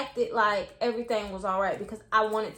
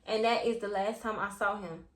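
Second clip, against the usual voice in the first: ordinary delivery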